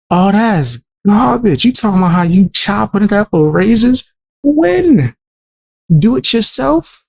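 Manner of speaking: can't believe what he's hearing, pitch way up; incredulous outrage. Real, not acted.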